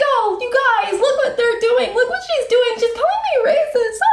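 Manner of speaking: very high mock victim voice; mocking whiny voice. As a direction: squeal like a tattletale. Mocking women's voice.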